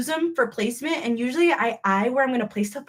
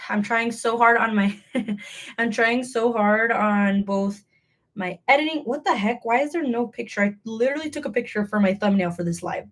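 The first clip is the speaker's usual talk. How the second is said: normal talking voice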